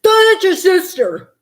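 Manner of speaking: slow, loud stern father voice; stern dad. Retold or imitated. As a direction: scold like a dad at the table. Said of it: "then he's like".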